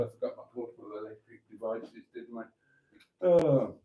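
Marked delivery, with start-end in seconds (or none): none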